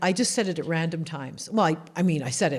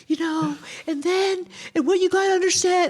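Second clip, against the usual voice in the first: high-pitched, hammed-up self-important explaining; mock yourself making a high, dramatic point; caught up in drama; 10 semitones higher, 3 dB louder than usual. Acting out herself mid-drama before the alarm, high-pitched mock earnestness. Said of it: "I'd be like".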